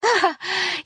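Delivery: a high, affected little laugh; high tittering laugh. A narrator's reading. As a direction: titter like a society lady. Harriet laughs at Callista's joke.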